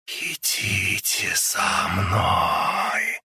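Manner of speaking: soft slow rustling whisper. A narrator's reading. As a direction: rustle it out slowly. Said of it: "прошелестел надоедливый голос".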